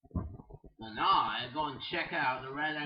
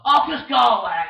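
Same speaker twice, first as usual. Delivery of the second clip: loud yell; 7 semitones higher, 16 dB louder than usual. Acted. Shouting at rival.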